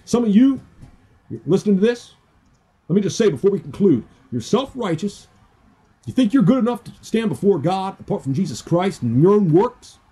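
rising, pressing preacher's rebuke; forceful preaching. Acted. Preacher confronting churchgoers, forceful. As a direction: call out the self-righteous.

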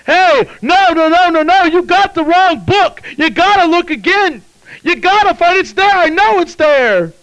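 frantic high-pitched shouted protest; shouting devil. Acted. Devil protesting to God over the book, shouting. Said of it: "the devil starts shouting".

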